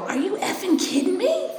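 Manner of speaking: high, laughing mock outrage; exasperated disbelief. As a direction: toss off an incredulous jab with a laugh. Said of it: "'Cause I wanna go".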